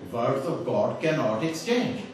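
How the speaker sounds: slow, with "cannot" joined in the right order; slow corrected quote. Retold or imitated. Speaker showing the intended wording, slow and deliberate. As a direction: give the intended "cannot exchange" slowly. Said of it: "he was actually wanting to say".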